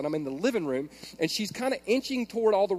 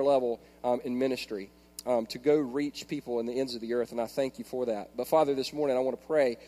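earnest, lower-voiced prayer; 6 semitones lower, 3 dB quieter than usual. Real, not acted. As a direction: pray in a lower, settling voice toward a soft amen. Opening prayer before the sermon.

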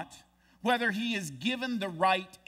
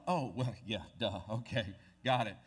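a lightly voiced dumb king, lower; sheepish duh, mildly hammed; dopey king; 6 semitones lower, faster than usual. King of Israel sheepishly catching on, lightly lower.

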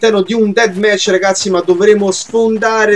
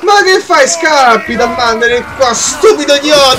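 high-pitched heated scolding; indignant taunting; 5 semitones higher than usual. Real, not acted. Gamer chasing a fleeing enemy, indignant yelling. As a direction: rail at the coward, voice pitched way up.

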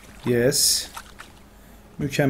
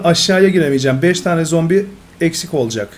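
ordinary talk, nothing marked

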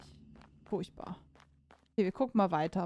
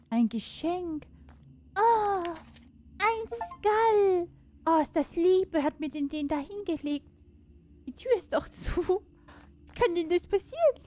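delighted squeal; 8 semitones higher than usual. Real, not acted. Gamer finding a skull as a gift, squealing with delight. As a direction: squeal over a cute present.

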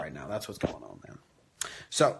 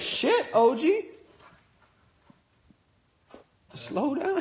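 high, slow startled call to the dog; startled by the gulping dog; surprised; slower than usual. Real, not acted.

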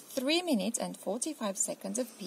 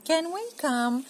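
put-on high, slow pleading kid; small child hoping to tag along; 8 semitones higher, 6 dB louder, slower than usual. Lester asks mum if they can come, high pleading.

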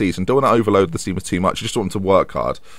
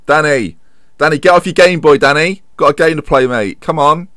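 calling out; 6 semitones higher, 5 dB louder than usual. Pretend. Calling a player off the bench.

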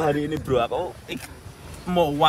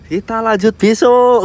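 loud slow call; shouting; 7 semitones higher, 9 dB louder than usual. Pretend. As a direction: shout it out slowly. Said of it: "Bengok-bengok ngono".

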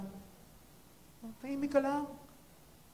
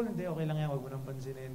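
soft, low reassurance to the wife; gently calm her down; consoling; 8 semitones lower, 8 dB quieter, faster than usual. Retold or imitated. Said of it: "trying to console".

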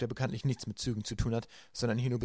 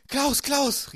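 much higher, louder urgent call; 7 dB louder than usual. A narrator's reading. Violet calls to Klaus across the reptile room, high and urgent. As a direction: call your brother urgently. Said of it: "rief sie".

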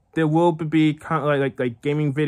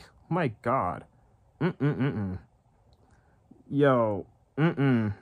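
ordinary talk, nothing marked

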